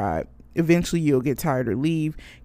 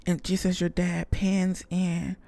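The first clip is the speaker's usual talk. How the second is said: normal talking voice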